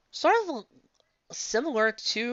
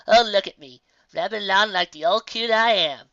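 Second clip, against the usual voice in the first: put-on louder, folksy old woman laughing at herself; self-mocking old coot; 7 dB louder than usual. Old woman laughing off her rambling, loud and folksy. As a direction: tease yourself like an old timer who talks too much.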